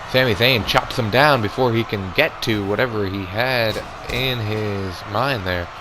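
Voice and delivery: ordinary delivery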